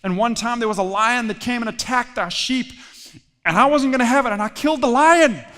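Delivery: tale rising to a triumphant shout; building bravado. Acted. David boasts of killing lion.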